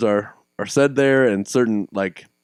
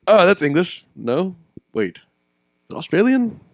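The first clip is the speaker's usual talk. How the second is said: mock puzzlement; 7 semitones higher, slower than usual. Acts out confusing two accents.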